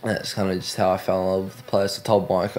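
ordinary delivery

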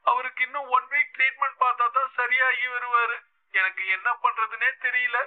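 pours out her troubles to him before breaking down; a distressed daughter rushing through her worries; tearful outpouring. A narrator's reading. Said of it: "என்று சொல்லி உடைந்து அழ ஆரம்பித்தாள் அஞ்சனா".

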